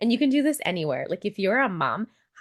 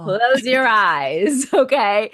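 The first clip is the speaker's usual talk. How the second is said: very slow, louder, giggling instruction; laughing instruction; 3 semitones higher, 8 dB louder, much slower than usual. Shown as a demonstration. Showing a listener how to check in, laughing slow guide. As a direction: stretch it out while laughing.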